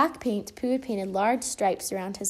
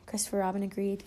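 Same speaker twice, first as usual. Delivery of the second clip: ordinary delivery, nothing marked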